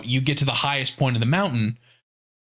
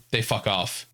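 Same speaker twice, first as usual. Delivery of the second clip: normal talking voice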